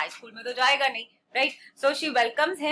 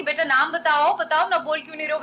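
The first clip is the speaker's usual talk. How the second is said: louder, higher, quick parental nagging; prompting parent; 4 semitones higher, 5 dB louder, much faster than usual. Acted. Parent pressing a shy child to answer, insistent prompting. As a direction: urge the child to speak up, hurriedly. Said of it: "जैसे parents बोलते हैं ना".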